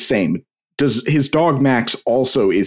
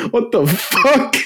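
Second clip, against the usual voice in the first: slow, high, stunned "what the fuck"; shocked disbelief; 11 semitones higher, slower than usual. Retold or imitated. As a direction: drag it out in horrified disbelief. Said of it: "They're just like".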